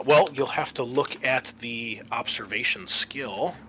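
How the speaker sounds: normal talking voice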